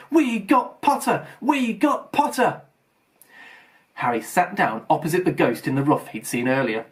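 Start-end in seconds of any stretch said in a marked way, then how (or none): 0.1-2.6 higher, slow gleeful chant; yelling. A narrator's reading. The Weasley twins cheering their new housemate, mild gleeful yell. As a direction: crow it across the table. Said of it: "the Weasley twins yelled".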